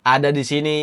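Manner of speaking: ordinary talk, nothing marked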